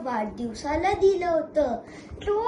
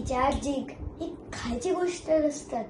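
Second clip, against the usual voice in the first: ordinary talk, nothing marked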